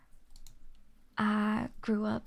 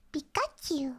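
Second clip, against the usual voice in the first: higher squeaky "Pikachu" with a falling end; 4 semitones higher than usual. Shown as a demonstration. Streamer doing a Pikachu impression for chat, light squeak. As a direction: chirp it like the Pokemon. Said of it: "can I do a Pikachu one?".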